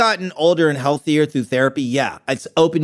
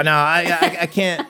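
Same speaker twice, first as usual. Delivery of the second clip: normal talking voice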